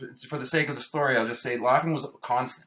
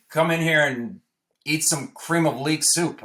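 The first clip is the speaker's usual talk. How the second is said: slow, higher, louder mock stern dad; stern father; 5 semitones higher, 4 dB louder, slower than usual. Father calling the high son to the table, slow and stern. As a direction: summon him slowly, a hint of menace.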